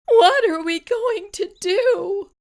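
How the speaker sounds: high, loud desperate mother; frantic, rising cry of despair; anguished cry. A narrator's reading. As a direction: wail it in panic. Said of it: "cried Missus Cream".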